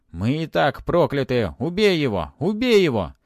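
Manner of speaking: high, loud clamour building up; defiant cries demanding a killing; shouting spirits. A narrator's reading.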